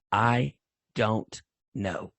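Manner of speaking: calm, very slow and deliberate; Bernie Kukar. Retold or imitated. Referee answering an agitated coach, calm and measured. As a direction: spell it out slowly, unruffled, word by word. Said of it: "Bernie's just calm".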